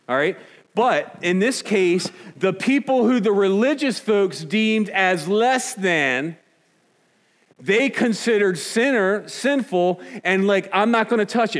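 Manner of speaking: high, loud preaching pitch; full-force preaching. Acted. Pastor firing up the congregation, preaching loud and high. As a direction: preach it hard and high to the room.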